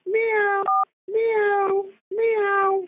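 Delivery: high-pitched imitation meowing; playful cat sound for a memory game. Shown as a demonstration. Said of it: "now one more time".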